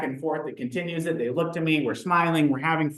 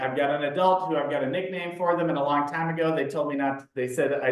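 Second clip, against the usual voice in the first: ordinary delivery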